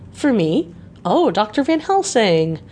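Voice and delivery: put-on pleased young woman caught off guard; surprised delight. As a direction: gasp it out, touched and surprised. Lucy surprised by gift.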